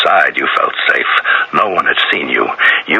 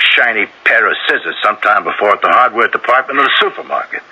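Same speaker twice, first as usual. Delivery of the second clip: ordinary talk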